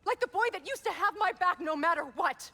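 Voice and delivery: screaming hurt at her brother; furious shout. Acted. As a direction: explode at him with betrayal. Climax of sibling quarrel.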